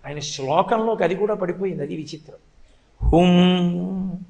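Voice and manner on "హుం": long, held, level "hum"; listening hum. Retold or imitated. Child following mother's bedtime story, drawn-out hum. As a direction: draw out one humming syllable. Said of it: "ఊ, ఊ, ఊ అన్న".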